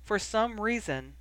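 ordinary delivery

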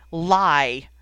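loud, outraged burst of disbelief; one loud, angry exclamation; enraged shout. A narrator's reading. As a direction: hurl the word out in fury. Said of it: "face had contorted with rage and disbelief".

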